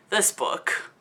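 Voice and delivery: breathy whisper, trailing away; lost for words, just whisper it. Real, not acted. Reviewer giving up on describing the book, whispering.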